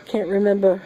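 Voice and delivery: high, laughing through the words. Real, not acted. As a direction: crack up mid-phrase. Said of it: "No, it's not S".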